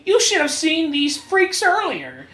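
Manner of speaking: smug nerd gloat. Acted. Afro Fuck mocking their fight, high smug sneer. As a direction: gloat down at them. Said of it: "kinda like".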